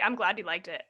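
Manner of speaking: normal talking voice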